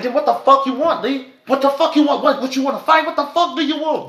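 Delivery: high, heated mock yell at Lee; retold yelling. Retelling how he yelled at Lee, high and heated. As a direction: shout it up high like squaring off.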